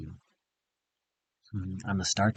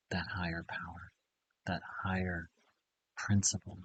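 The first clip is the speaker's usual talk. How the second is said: soft, low meditation voice; quietly guiding, with pauses between phrases; hushed, reverent; 3 semitones lower, 8 dB quieter than usual. Real, not acted. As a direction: let it drop to a gentle hush. Leading a meditative visualization.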